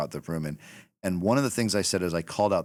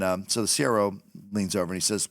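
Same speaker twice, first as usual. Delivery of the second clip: usual speaking voice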